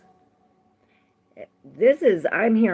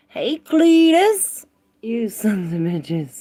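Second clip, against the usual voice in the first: mock rowdy greeting; 3 semitones higher, 7 dB louder, slower than usual. Playful put-on greeting to viewer.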